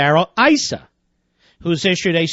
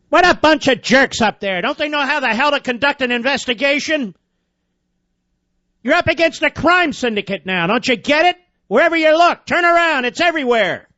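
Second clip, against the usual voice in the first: high-pitched, indignant outburst; exasperated rant; 7 semitones higher than usual. Real, not acted. Host scolds committee chairmen for incompetence, exasperated. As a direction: berate them, pitch climbing as you go.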